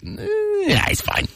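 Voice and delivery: put-on the second-kid parent who can't be bothered; lazy dismissal. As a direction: brush it off without getting up. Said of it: "You're like".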